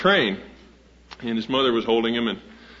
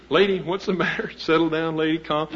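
higher, half-laughing mock conductor; 6 semitones higher than usual. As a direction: imitate the conductor while chuckling, pitched up. Retold conductor soothing wailing mother.

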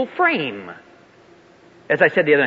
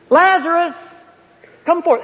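loud, high summons; calling out; 8 semitones higher, 6 dB louder than usual. Retold or imitated. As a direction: call loudly into a tomb. Repeats Jesus' call to the tomb.

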